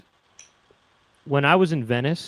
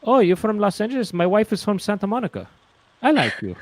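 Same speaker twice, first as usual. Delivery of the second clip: put-on eager Italian-accented question, higher; Italian seller; 8 semitones higher than usual. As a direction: ask it like a pushy street vendor.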